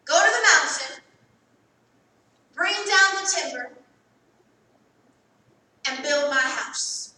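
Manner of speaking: commanding sermon cadence, slowing on build; preaching God's command. Acted. Preacher to congregation, proclaiming God's command. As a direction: proclaim it like the Lord's order.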